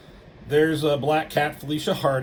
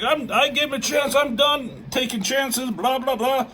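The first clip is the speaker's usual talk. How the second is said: high, hammed-up arguing; mock argumentative yelling; retold yelling back; 11 semitones higher than usual. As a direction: imitate a heated self-defense, pitched way up. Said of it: "They start yelling at him".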